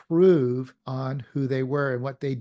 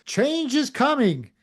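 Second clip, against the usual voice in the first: higher, proclaiming like a leader at a rally; rally speaker; 8 semitones higher, 3 dB louder than usual. Retold or imitated. Speaker imitates someone at a rally announcing change, proclaiming. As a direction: announce it from a stage. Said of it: "stands up at the front".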